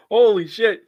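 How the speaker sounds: high, loud stunned exclamation; real astonishment. Real, not acted. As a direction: blurt it out, floored by the number. Stunned by the betting spread.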